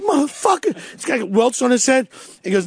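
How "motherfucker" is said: pained groan. Retold or imitated. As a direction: groan it out after the beating. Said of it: "and goes".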